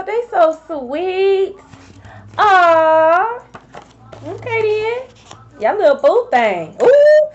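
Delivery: slow, drawn-out teasing aw; teasing coo. Real, not acted. Reacting to a sweet card message, teasing coo. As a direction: coo at it like it's adorable.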